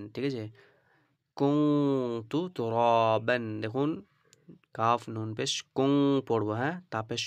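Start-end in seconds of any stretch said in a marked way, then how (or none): 1.4-3.4 কুনতু with the ন hummed through the nose; nasal ghunnah demo. Shown as a demonstration. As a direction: sound কুনতু nasally, then তুরাবান clearly. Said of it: "নাকের মধ্যে আওয়াজ করবো". (কুনতু তে নাকে আওয়াজ করে পড়া)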